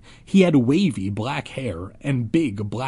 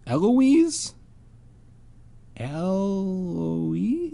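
puzzled; 7 semitones higher, much slower than usual. Real, not acted. Puzzled at the name.

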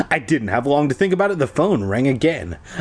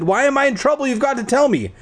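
much higher, urgent insisting; 12 semitones higher, faster than usual. A narrator's reading. Ricky pleading with Iris on the phone, high and insistent. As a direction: press her for an answer, pitch climbing. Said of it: "I insisted".

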